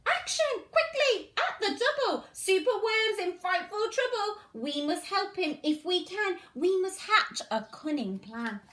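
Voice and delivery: lively, sing-song storybook reading; animated picture-book read-aloud; reading to children. Acted. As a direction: read it bright and bouncy for little ones.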